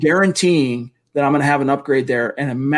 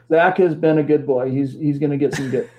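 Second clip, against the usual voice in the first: lightly voiced jolly Santa; a hint of Santa Claus, playful.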